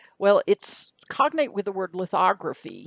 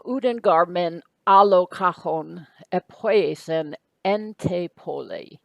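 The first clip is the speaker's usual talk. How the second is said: slow, careful reading of the Greek, a little louder; 5 dB louder, slower than usual. Shown as a demonstration. Teacher reads the next Greek sentence to students, slow and careful. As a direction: set each Greek word down slowly for students. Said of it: "So find your verb".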